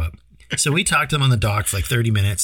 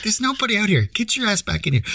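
lightly voiced wife, pitched higher; a light, higher impression of a scolding wife; a hint of the wife; 9 semitones higher than usual. Wife calling her husband back into the tent, higher mock scold.

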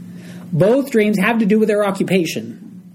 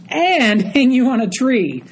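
mock dramatic reading; 8 semitones higher than usual. Shown as a demonstration. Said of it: "as if that's not enough".

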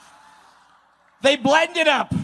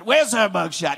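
usual speaking voice

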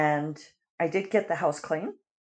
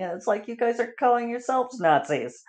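ordinary talk, nothing marked

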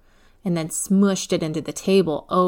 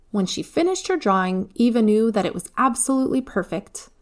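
ordinary delivery, nothing marked

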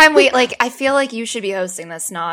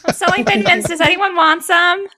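playful mock voice; 8 semitones higher, 3 dB louder than usual. Acting Kelly selling mints.

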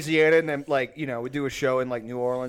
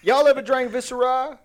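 Cajun guy voice; 8 semitones higher than usual. Pretend. Podcast host riffing on a misheard word, mock Cajun guy voice. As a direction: put on a comic Cajun accent. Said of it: "like, Cajun guy voice".

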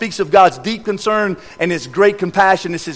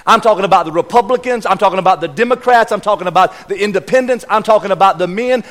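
normal talking voice

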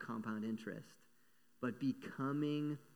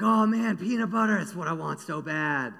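higher, much louder excited squeal of desire; an eager mouse who can't resist the bait; craving mouse; 3 semitones higher, 10 dB louder than usual. Acted. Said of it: "The mouse is like".

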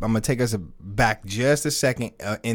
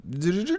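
high, rapid "do-do-do" rewind imitation; fast-forward noise; 8 semitones higher, much faster than usual. Co-host fast forwarding back to the party, playful noise. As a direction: imitate a tape skipping ahead.

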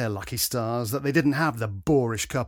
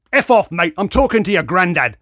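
loud, raised-pitch dismissal; spitting anger; 6 semitones higher, 11 dB louder than usual. A narrator's reading. Lout brushing off the younger man, spitting anger. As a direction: spit it out at him. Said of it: "spat Grey Ponytail".

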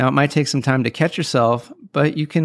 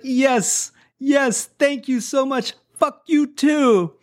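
very high, delighted, naive thanks; obliviously cheerful newcomer; 13 semitones higher, slower than usual. Retold or imitated. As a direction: return the insult as if it were a compliment, high and drawn out at the end. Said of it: "he doesn't know that that's really an insult".

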